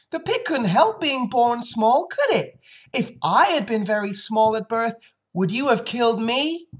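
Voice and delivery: put-on high, loud pleading child; cried-out protest. Fern pleads the pig's case to her father, crying out. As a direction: argue in a high, distressed voice. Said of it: "cried Fern".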